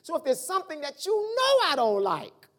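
normal talking voice

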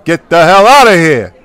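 angry shout. Acted. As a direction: throw him out loudly. Mobster boss throws him out.

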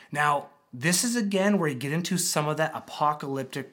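ordinary delivery

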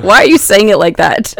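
loud, laughing mock disbelief; amused mock questioning. Wife teasing husband over his website delivery, laughing mock disbelief. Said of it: "I'm looking at him like".

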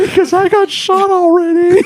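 very high tearful wail; play-acted sobbing whine; mock crying. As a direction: blubber it like a hammed-up crybaby. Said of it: "And they start crying".

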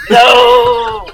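loud, high, drawn-out mock wail; retold horrified denial. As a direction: cry out in comic horror. Acts out horrified denial to friends.

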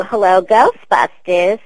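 slow, loud Queens receptionist answering the phone; Janine voice. Shown as a demonstration. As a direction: drag out the greeting like a New York office girl on the line. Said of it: "Always love whenever people break in the voice".

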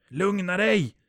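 high, loud, forceful shout; shouted command. A narrator's reading. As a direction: shout down someone in hysterics. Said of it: "skriker Alexander". (skriker uppfordrande)

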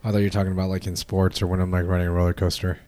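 usual speaking voice

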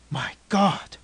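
aghast stage whisper; hushed shock, pushed hard; horrified whisper. A narrator's reading. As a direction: breathe it out in awe. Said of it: "whispered Stanley".

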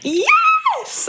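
very high, drawn-out cheer; excited shout. Real, not acted. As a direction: cry out in shrieking agreement. Exclaims at friend's sharp insight.

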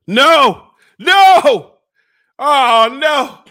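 loud, drawn-out wails of "no"; agonized shouting. Real, not acted. Suffering host, as the burn hits, agonized shouting. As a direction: wail it out as the pain hits.

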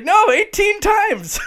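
very high, slow, laughing delivery; squeaky laughing mock answer; laughing punchline. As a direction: stretch the punchline out while cracking up. Retold golf punchline, laughing.

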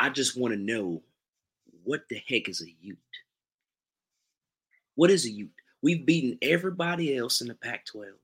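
aggrieved Southern sports fan; Southern accent. Acted. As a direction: lament it in a put-on Southern accent. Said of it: "in your best Southern accent".

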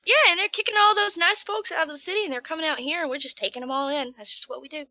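proud farmer. Retold or imitated. S0 as farmer taking in refugees, loud folksy pride. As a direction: boast of country kindness.

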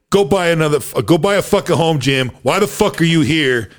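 high-pitched, mock angry rant; aggressive and confrontational. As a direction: imitate a friend confronting someone at full tilt. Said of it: "aggressive and confrontational".

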